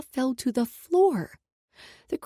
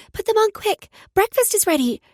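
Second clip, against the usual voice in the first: put-on excited little girl; 11 semitones higher, 8 dB louder than usual. Child voice, Brooklyn.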